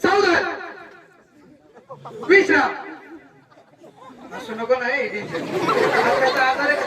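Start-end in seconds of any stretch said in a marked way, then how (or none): 0.0-0.4 high, sharp call to attention; sudden raised-pitch order; drill command. Pretend. Shouted drill command to child. 2.3-2.7 loud, sudden at-ease order; punchy parade-ground command; drill command. Pretend. Shouted drill command to child.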